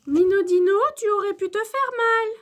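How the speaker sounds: high, loud scolding teacher; maîtresse qui rouspète. A narrator's reading. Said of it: "rouspète la maîtresse". (maîtresse qui gronde fort)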